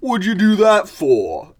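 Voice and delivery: loud, high, drawn-out complaint; hammed-up outraged bruiser; indignant mole. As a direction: demand an explanation, loudly aggrieved. Stabbed mole accuses the other.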